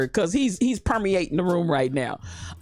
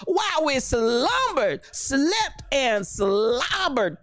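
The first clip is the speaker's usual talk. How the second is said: slow, higher, solemn mock gravity; serious voice; 7 semitones higher, much slower than usual. Shown as a demonstration. Host mocks a solemn prayer voice, slow and grave. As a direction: intone it like a grave sermon, very slowly. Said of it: "in your serious voice".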